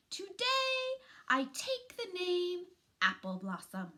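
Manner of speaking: high, loud and proud; proud declaration. Acted. The littlest possum declares her new name, high and loud. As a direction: announce your new name to everyone.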